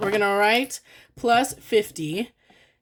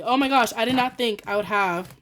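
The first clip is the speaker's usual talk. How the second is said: usual speaking voice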